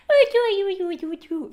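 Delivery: high-pitched cooing that starts loud and tails off; excited baby-talk noises to a pet; coaxing dog coo. Retold or imitated. Owner calling her dog over, high coaxing coo. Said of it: "I have to be like".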